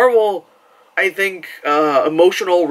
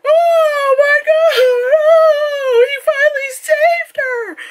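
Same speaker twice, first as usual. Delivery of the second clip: high, slow, loud swooning; gushing fans; 4 dB louder, slower than usual. Retold or imitated. Fans swooning as he holds her, high and loud. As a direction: swoon over the rescue. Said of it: "and they were like".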